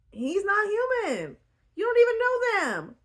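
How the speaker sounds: high, loud incredulous rant; exasperated. Real, not acted. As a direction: throw up your hands in disbelief. Exasperated rant about alien.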